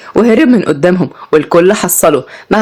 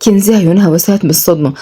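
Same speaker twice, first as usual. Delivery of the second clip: plain reading voice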